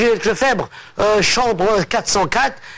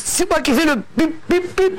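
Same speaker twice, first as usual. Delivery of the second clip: stammered beeps; 7 semitones higher than usual. Acted. Stutterer makes the beep sound.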